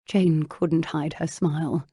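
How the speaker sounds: plain reading voice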